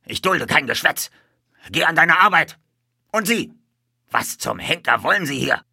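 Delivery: high, loud scolding; grim stable boss barking orders; angry shouting. A narrator's reading. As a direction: chew out the worker, then round on the stranger. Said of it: "schrie er".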